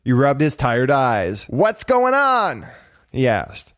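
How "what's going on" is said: high, drawn-out question; puzzled cricket. A narrator's reading. Jiminy rubbing his tired eyes, puzzled. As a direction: ask slowly, pitched up, just woken. Sleepy cricket asks about commotion.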